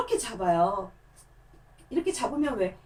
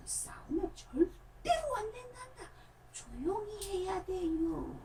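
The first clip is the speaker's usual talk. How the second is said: much higher, softer, slow voice with a sob in it; soft weepy puppet voice, very high; tearful puppet plea; 8 semitones higher, 8 dB quieter, much slower than usual. Acted. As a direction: plead through tears, hushed and slow. Puppet voice pleading in demo.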